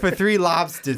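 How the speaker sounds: "lobstahs" in mock Boston; drawn-out fake Boston accent; pretend Boston accent. Cohost bragging about lobsters, pretend Boston accent. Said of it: "Do you like my Boston accent?".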